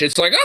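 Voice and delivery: slow, high cackle; witchy laugh. Retold or imitated. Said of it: "witchy fucking laugh".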